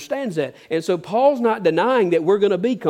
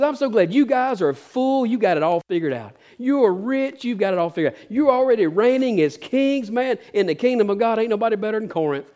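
higher-pitched mock praise with swooping emphasis; seething sarcasm; 5 semitones higher than usual. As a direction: gush over them sarcastically, as if impressed. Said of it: "put a little seething sarcasm in there".

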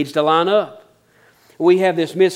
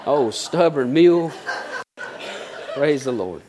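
laughing. Real, not acted. Laughing at own joke.

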